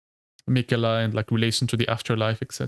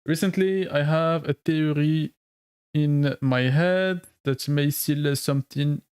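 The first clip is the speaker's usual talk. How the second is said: put-on French accent; 2 semitones higher, slower than usual. Streamer reads a viewer's message aloud in a mock French accent. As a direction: ham up a French accent while reading the message. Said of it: "I'm reading this with a French accent".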